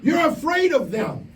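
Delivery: high, slow, ringing pulpit call; full-force preaching. Acted. Preacher rousing campers at camp, slow high pulpit cry. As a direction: drive it home to the whole room.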